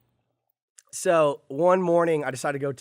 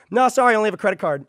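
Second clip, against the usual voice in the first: higher, louder and fast, brushing him off; hurried refusal; 8 semitones higher, 10 dB louder, faster than usual. Retold or imitated. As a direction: blurt it out and keep moving. Refusing an armed stranger quickly.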